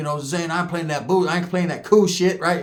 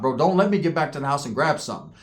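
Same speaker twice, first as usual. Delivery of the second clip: normal talking voice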